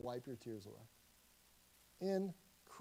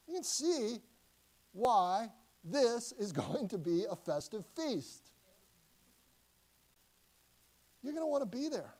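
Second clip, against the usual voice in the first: excited invitation; 5 semitones higher, 6 dB louder than usual. Acted. Inviting to festive feast.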